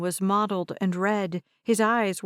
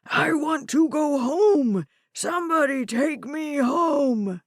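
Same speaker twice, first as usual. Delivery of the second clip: screaming; 6 semitones higher than usual. Acted. Old man screaming to be taken home. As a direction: scream it to the room, desperate. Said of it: "Gramps screamed".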